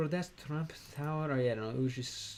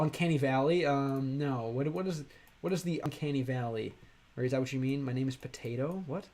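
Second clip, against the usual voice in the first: normal talking voice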